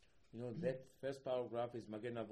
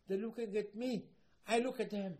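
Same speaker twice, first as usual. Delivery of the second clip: high, loud voicing of an excited God; excited exclamation; 8 semitones higher, 7 dB louder than usual. Hashem telling of His people looking, acted excitedly. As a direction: exclaim it with raised, forceful delight. Acting Hashem delighted at being looked at.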